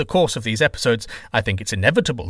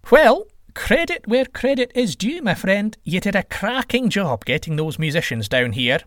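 high-pitched, sly mocking god voice; teasing trickster god; 8 semitones higher than usual. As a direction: needle him with a grin. Trickster god teases Quetzalcoatl.

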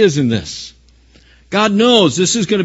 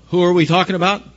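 ordinary talk

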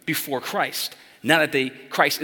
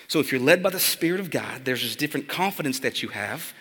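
ordinary talk, nothing marked

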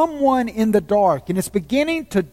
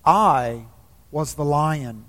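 solemn lion voice; 5 semitones lower, slower than usual. A narrator's reading. The lion reveals himself to Shasta, low and solemn. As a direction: drop low and let it land.

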